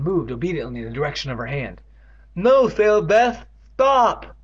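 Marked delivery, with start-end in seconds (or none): 2.3-4.3 much higher, louder desperate plea; pleading. Acted. Catherine begging Sarah Beth to stop, loud plea. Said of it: "Catherine pleaded".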